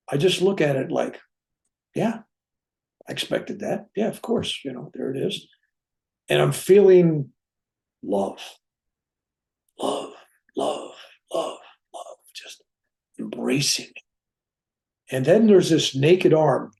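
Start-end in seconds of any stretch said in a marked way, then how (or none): 1.9-5.5 soft, matter-of-fact acknowledgement; understated, unimpressed acceptance; unexcited acceptance. Retold or imitated. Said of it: "I'm not excited. I'm not in awe". 8.0-14.0 soft, near-whispered reverence; hushed, savoring repetition; hushed tenderness. Real, not acted. Reliving an embracing feeling of love.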